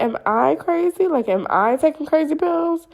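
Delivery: high-pitched acted disbelief; bewildered self-doubt. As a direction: question your own sanity, hammed up.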